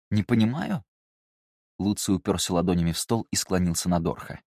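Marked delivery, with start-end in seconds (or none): 0.1-0.9 offended, rising retort; higher indignant question. A narrator's reading.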